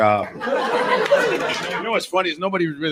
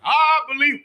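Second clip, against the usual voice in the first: high, loud preachy voice; high hammed-up sixties speechmaker; mock sixties orator; 4 dB louder than usual. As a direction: parody a grand speech.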